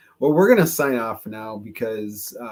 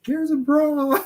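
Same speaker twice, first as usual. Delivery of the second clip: starstruck fan; slower than usual. Retold or imitated. Mocking a fan throwing bra.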